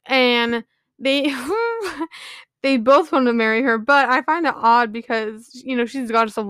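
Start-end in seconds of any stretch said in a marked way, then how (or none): 1.4-2.3 light giggle. Real, not acted. Laughing at the gods' romance.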